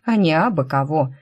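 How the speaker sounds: usual speaking voice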